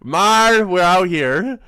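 shaky attempt at a Boston accent, high and loud; badly faked Boston accent, played big. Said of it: "I cannot do the Boston accent".

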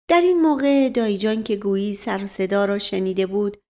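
usual speaking voice

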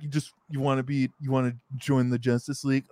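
fast, lightly voiced Superman; Superman impression. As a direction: rattle it off as Superman. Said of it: "Superman came to him and was like".

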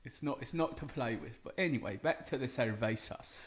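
lightly done cockney bloke, mate-style; put-on British accent. Guest on the show, answering in a light mock British accent. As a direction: play a chatty Brit. S0 plays British character Welvin.

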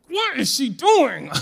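high, drawn-out disbelief; mock-exasperated high cry; exasperated parent. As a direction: wail it like a baffled parent in the stands. Retells her exasperated reaction at game.

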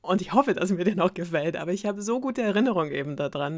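usual speaking voice

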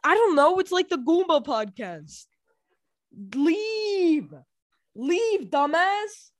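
loud, high exasperated yelling; shouting. Real, not acted. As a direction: yell him out of the room. Yelling at brother to leave.

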